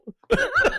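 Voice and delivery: breaks into a high-pitched giggle; can't hold back the laugh. Real, not acted. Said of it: "I can't even say it without laughing".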